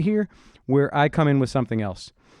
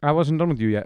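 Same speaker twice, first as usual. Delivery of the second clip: ordinary delivery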